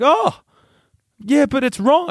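high-pitched imitation of a fretting mother; disapproving mum. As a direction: protest weakly in mum's high voice. Retold mum still disapproving.

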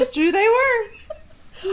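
high, slow, words stretched by laughing; helpless laughter. Real, not acted. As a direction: squeeze the words out through laughter. Cracking up over band name.

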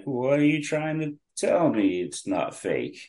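high mock-incredulous challenge, dropping at the end; side-eyed skepticism. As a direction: mimic a doubter challenging you. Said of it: "start giving me the side eye".

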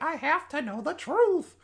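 high-pitched, hammed-up desperate heroine; mock melodrama. Mock dramatic quote of movie character.

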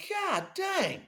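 very high-pitched exclamation; mock astonishment. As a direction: squeal it in hammed-up disbelief. Band's shocked reaction, retold.

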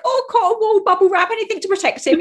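high, loud and fast fretting mother; overprotective panic. Retold or imitated. Mother fretting over her dating teen son, high, loud and fast. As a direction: flap like a mum who'd wrap him in bubble wrap. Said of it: "I'm just like".

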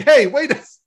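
high, loud cut-off protest; indignant "hey!" thrown out high and loud; protesting call. Retold or imitated. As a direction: call out to stop someone leaving, broken off mid-word. Voicing woman being hung up on.

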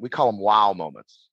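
ordinary delivery

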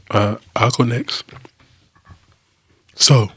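low, close-mic rumble; deep voice. Real, not acted. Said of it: "Your voice is so deep".

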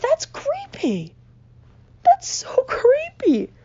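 very high, drawn-out squeal of disbelief; comic creeped-out protest. Acted. Comic appalled by a fairy collecting teeth, squealing protest. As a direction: stretch it out high, hammed-up horror.

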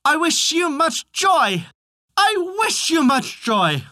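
very high, slow chanted call; drawn-out high-pitched refrain; repeated cry. A narrator's reading. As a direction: stretch it into a high singsong cry. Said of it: "ordered him to repeat".

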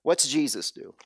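ordinary delivery

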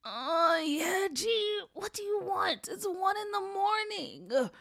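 high, drawn-out groggy complaint; sleepy groaning. Acted. Brother woken by his sister at night, sleepy groaning.

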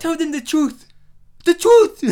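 imitating Will Smith's African accent, loud and high. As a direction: intense mock African accent. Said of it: "like, an African accent".

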